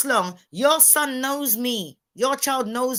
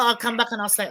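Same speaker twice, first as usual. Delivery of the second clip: ordinary talk, nothing marked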